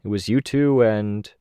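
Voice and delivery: usual speaking voice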